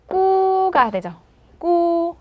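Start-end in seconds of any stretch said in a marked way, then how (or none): 1.6-2.2 "꾸" held high and level; tense k into a long, higher u in "꾸"; teacher repeats a stretched "꾸"; demonstrating "꾸". Shown as a demonstration. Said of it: "기억나세요".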